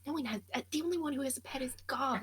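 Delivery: ordinary delivery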